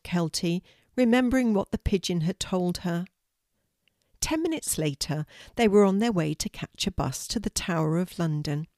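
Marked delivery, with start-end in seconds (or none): none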